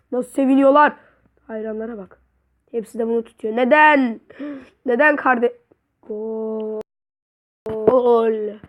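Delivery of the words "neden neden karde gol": ordinary talk, nothing marked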